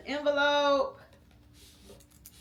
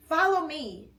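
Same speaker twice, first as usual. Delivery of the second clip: grinning Theo; 7 semitones higher, 5 dB louder than usual. Acted. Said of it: "Theo said with a grin".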